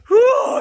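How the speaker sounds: loud high "woo"; high, full-voiced cheer; whoop. Real, not acted. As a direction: let out an excited whoop. Whooping at the view.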